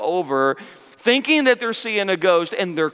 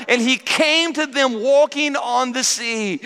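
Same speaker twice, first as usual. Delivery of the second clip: higher, building awe; awed excitement; 7 semitones higher than usual. Acted. Preacher exclaims the verse in awe.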